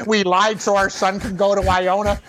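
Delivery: words breaking into laughter; laughing mock confession. Co-host posing as guest's parents, laughing confession. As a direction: stammer it out through giggles.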